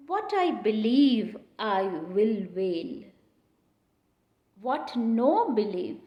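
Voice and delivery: very slow, weighted reading of the quote; teacher reciting the verse slowly; slow recitation. Shown as a demonstration. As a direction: draw the line out word by word.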